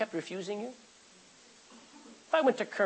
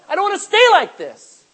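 crying out; 13 semitones higher, 13 dB louder than usual. Acted. Sinner's plea, continued.

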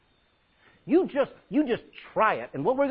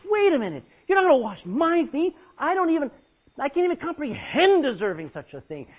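high and loud objection; Peter protesting; 8 semitones higher, 6 dB louder than usual. Acted. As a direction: protest urgently, unworthy.

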